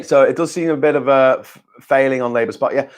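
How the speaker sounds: ordinary talk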